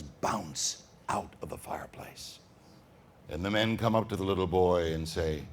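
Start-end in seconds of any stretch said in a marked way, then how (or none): 0.0-2.4 slow, hushed whisper; eerie whisper. A narrator's reading. Storyteller whispering about the skulls, slow and hushed. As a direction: breathe it out slowly and eerily. Spooky narration.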